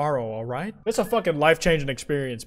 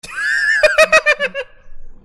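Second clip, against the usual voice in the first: high, loud, shrieking villain cackle; a wild, screeching laugh thrown at the room; maniacal cackle; 8 dB louder than usual. Acted. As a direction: cackle out like a mad jester. Sudden loud game laugh voiced.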